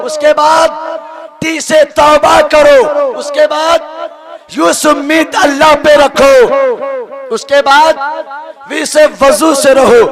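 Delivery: loud, rousing call-and-response preaching; preaching at full force. Acted. Preacher leading the congregation through the alphabet, fiery preaching. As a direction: drive each letter home to the crowd like a fiery preacher.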